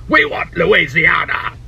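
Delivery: slow mock posh English accent; "we want Louisiana" in a hammed-up English accent. Retelling history, host voices the English in an English accent. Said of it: "the English were like".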